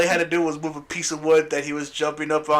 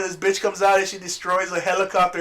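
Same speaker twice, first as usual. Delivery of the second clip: usual speaking voice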